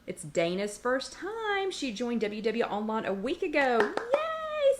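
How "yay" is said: excited cheer. Real, not acted. Welcoming a first-time viewer.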